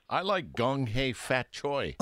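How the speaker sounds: "Gong Hei Fat Choi" said slowly, syllable by syllable, falling at the end; careful Cantonese try. Shown as a demonstration. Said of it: "am I saying that right".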